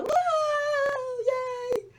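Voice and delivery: very high, squealed cheers; little girls delighted to go, squealing. A narrator's reading. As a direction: squeal for joy. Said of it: "a lot of squealing and jumping".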